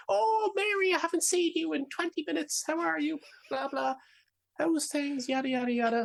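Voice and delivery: very high gushing voice of a gossiping woman; chatty woman. Retold or imitated. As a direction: gush like a neighbour meeting a friend. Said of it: "And you're just like".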